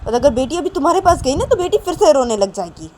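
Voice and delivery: loud, high, emphatic scolding; stern refusal. A narrator's reading. Manisha refusing crying Nisha the baby, scolding. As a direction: refuse firmly, telling her off.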